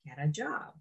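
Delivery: low, very soft, kindly instruction; gentle inner voice. Acted. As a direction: drop low and softly guide. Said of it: "talks to you in a very gentle, loving, kind way".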